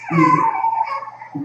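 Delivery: sobbing break on a high cry; the word breaks into a high tearful cry. Retold or imitated. Dreamer retelling the Lord calling him, tearful high cry.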